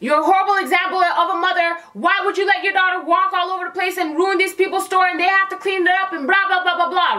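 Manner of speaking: mock rant, running on and on; retold cussing out. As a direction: tear into a bad mother, imitating an outraged bystander. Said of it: "the lady's cussing her".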